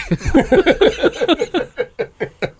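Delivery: long, high falling laugh. Real, not acted. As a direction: let the laugh spill out high. Laughing at own story.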